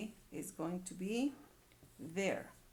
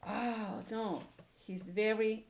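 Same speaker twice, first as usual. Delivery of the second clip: ordinary talk, nothing marked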